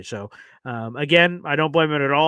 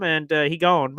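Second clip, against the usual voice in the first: ordinary talk, nothing marked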